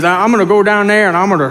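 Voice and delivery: shaky attempt at a thick New Orleans accent. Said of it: "in [name]' accent".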